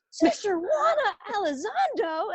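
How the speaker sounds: high, rising disbelief on "Elizondo"; the sisters can't believe who she met; mock astonishment.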